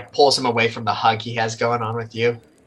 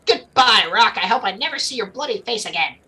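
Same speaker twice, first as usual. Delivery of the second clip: put-on high, fast, huffy young man; Connor voice; 10 semitones higher than usual. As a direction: storm off in a high huff. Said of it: "he's like".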